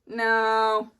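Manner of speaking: loud, drawn-out protest; a real shout of refusal. Retold or imitated. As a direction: yell it out in pain. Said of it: "And then".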